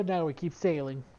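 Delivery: ordinary talk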